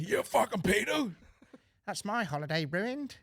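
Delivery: a hammed-up yell of outrage; mock outraged vigilante. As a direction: ham up an outraged vigilante yelling. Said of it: "you know, that guy".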